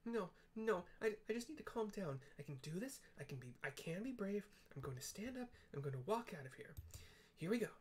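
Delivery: high, frantic little voice talking himself into bravery; a tiny scared engineer psyching himself up; panicky little Wedge. Acted. Hiding Wedge psyches himself up, high and panicky. Voicing Wedge in bandit lair.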